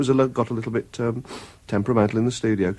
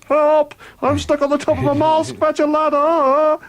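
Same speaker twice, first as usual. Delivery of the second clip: much higher, drawn-out call from a minaret; mock muezzin call; 3 dB louder, slower than usual. Joking about the muezzin's call, a mock wailing call. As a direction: wail it like a muezzin calling from the mosque. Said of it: "what do they call the fellow who sings off the top of the mosque".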